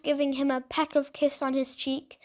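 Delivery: usual speaking voice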